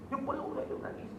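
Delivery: a hushed emotional memory; hushed, near whisper. Real, not acted. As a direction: drop to a choked hush while recalling it. Retelling father crying, voice fades.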